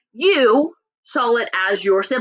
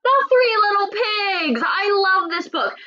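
high-pitched, drawn-out excited reveal; delighted; 9 semitones higher than usual. Real, not acted. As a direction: gush over a beloved book, voice up high. Delighted at showing favourite book.

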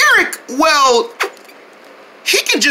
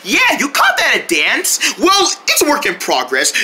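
ordinary delivery

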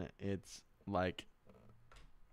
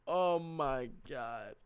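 stunned; 3 semitones higher, much slower than usual. Real, not acted. Overwhelmed after sweet fan chat.

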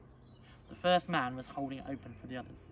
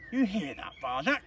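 lightly voiced proud father, raised voice; 6 semitones higher, 7 dB louder than usual. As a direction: show off with pride. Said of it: "said Father proudly".

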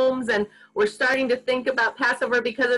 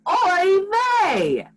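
call-and-response cue; 8 semitones higher than usual. Shown as a demonstration. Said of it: "repeat after me and say".

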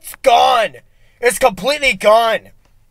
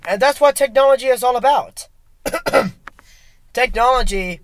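mock inspiring speech; 3 semitones lower than usual.

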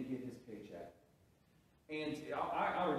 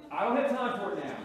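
louder and higher, fed-up retelling; annoyed outburst; 6 semitones higher, 9 dB louder than usual. Retold or imitated. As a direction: snap back at the pestering teen. Retold angry brush-off.

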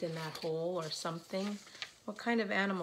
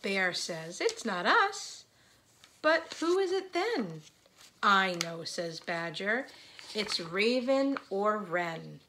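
put-on read-aloud to children; slower than usual. A narrator's reading. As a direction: lift the characters' questions above the narration. Reads a picture book aloud.

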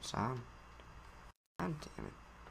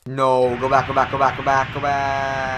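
louder, rapid repeated pleas; frantic yelling; 9 dB louder than usual. Real, not acted. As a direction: yell it back urgently. Yelling at game to reverse.

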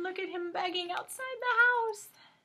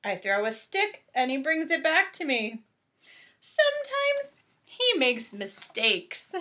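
lightly animated storybook narration; reading to children; 5 semitones lower, 5 dB louder than usual. A narrator's reading. Reading Clifford aloud to a child, gentle storybook voice. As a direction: read it out gently to little ones. Reads picture book aloud.

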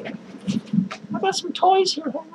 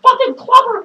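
angry outburst; 6 semitones higher, 8 dB louder than usual. Acted.

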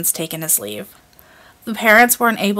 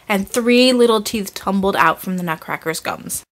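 plain reading voice

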